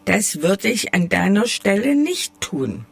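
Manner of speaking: plain reading voice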